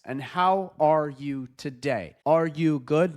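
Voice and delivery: dragged-out, word-by-word robot delivery; slow robotic speech. Shown as a demonstration. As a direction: speak like a sluggish robot, stretching every word. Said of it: "very few humans talk like this".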